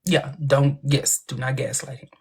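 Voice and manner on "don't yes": ordinary talk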